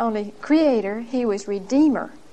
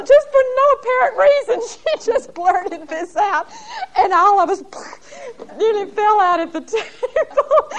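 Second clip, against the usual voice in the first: high voice breaking into real laughter; can't get it out for laughing; laughing hard; 10 semitones higher, 3 dB louder than usual. Real, not acted. Recalling the aunt's shocking outburst.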